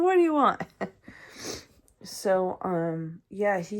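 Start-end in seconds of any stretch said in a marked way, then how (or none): 0.0-0.6 higher, louder sassy snap; big attitude. Retold or imitated. Young girl sassing a stranger in a car, mouthy. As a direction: talk back like a mouthy kid. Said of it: "I had big attitude back then".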